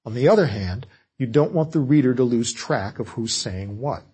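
normal talking voice

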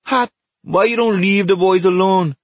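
high, loud protest; calling out. Acted. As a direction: call it out from under a car. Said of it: "Baku called".

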